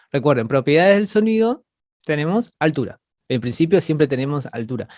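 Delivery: normal talking voice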